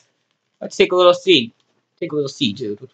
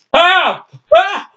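high-pitched mock cry for help; play cry for help; 9 semitones higher than usual. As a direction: yelp for help as a wrestler in play. Wrestler in trouble in play match.

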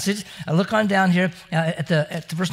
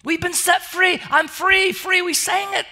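joyful proclamation; 9 semitones higher, slower than usual. Acted. Joyful preaching climax.

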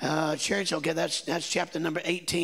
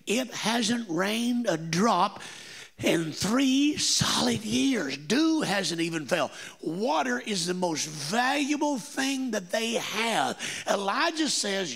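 slow, raised, emphatic preaching voice; 4 semitones higher than usual. Acted. Preacher building to the climax for his congregation, slow and emphatic. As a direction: drive each phrase home from the pulpit.

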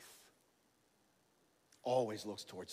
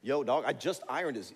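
diva; 2 semitones higher, 7 dB louder than usual. Retold or imitated. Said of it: "is Jesus the diva".

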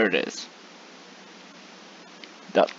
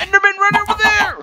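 being chased; 4 dB louder, faster than usual. Real, not acted. Calling out while fleeing.

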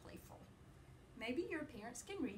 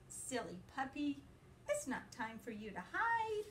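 lively, sing-song picture-book reading; reading to children; 5 semitones higher than usual. A narrator's reading. Teacher reading a puppy book to her class, playful and lively. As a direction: read it brightly to little listeners.

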